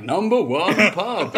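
voice from the ether. Pretend. Guest imagining the podcast's countdown, mock announcer voice. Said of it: "some voice from the ether would be like".

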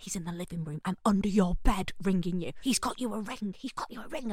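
hushed, urgent mock whisper; mum whispering. Mum hiding under the bed, hushed and urgent. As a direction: keep it low and secret, half-hearted hush. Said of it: "Why are you whispering?".

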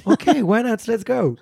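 high, laughing "ok why not"; amused, game for it; laughing agreement. Real, not acted. Playful offer to switch to English.

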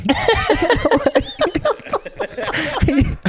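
high-pitched laughing fit; high giggle. Retold or imitated. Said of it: "I just started laughing".

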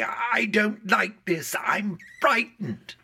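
high, slow, loud wail of fright; drawn-out, high-pitched scared shout. Retold or imitated. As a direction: imitate a frightened youngster crying out loudly. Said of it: "for shouting out loudly".

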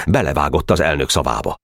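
ordinary delivery, nothing marked

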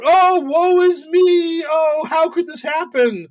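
high, slow melodramatic wail; mock woe. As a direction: ham up the self-pity like a tragic heroine. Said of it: "when you fuck around and find out".

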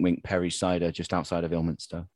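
ordinary delivery

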